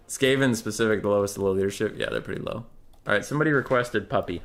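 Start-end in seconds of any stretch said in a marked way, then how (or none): none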